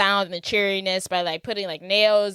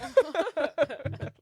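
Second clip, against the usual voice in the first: laugh; 7 semitones higher, 4 dB quieter than usual. Retold or imitated. Laughing mid-impression.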